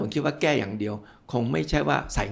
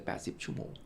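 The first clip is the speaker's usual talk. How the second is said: words fade to a voiceless whisper; almost inaudible trailing off; 13 dB quieter than usual. Real, not acted. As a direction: let the number die away under the breath. Number trails off, then repeated.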